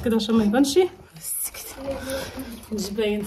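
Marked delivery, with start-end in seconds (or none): 1.2-3.3 hushed whisper. Real, not acted. Wife hiding the camera, whispering. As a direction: breathe it so no one hears.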